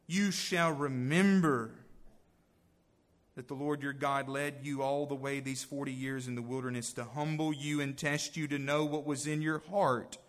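preaching scripture. Acted. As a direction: proclaim the verse to the congregation. Reads scripture at full force.

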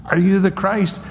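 taunting the prisoner with a high jeering voice; mock yelling council. Preacher voicing the council jeering at Jesus. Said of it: "they're all yelling".

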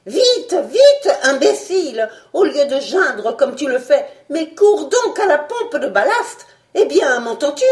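high, loud barked orders; angry command. Acted. The doctor shakes panicking Fred, angry shouted orders. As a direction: berate him to get moving.

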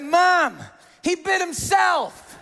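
put-on high, drawn-out kid whine; protesting child. Boy blames bitten brother to mom, mock indignant protest. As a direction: play a boy insisting on an absurd excuse. Child voiced making absurd excuse.

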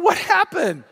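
high-pitched, bewildered outcry; mock dismay. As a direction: playact a man whose hopes just collapsed. Husband finds his tires replaced.

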